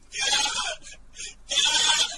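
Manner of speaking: loud sobbing calls for father; wailing cry. A narrator's reading. Daughter wailing over her father's body. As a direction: wail out for the dead father. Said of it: "Giàng Khuê khóc gào".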